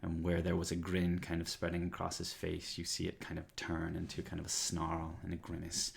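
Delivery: usual speaking voice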